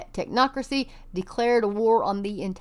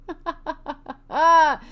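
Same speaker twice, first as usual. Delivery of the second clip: high mocking laugh; 7 semitones higher, 5 dB louder than usual. Real, not acted. Laughing at publicity stunt.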